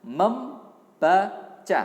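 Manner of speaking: very slow, loud, syllable-stretched; sounding out words. Shown as a demonstration. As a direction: stretch each syllable loudly for the class. (mengeja pelan per suku kata)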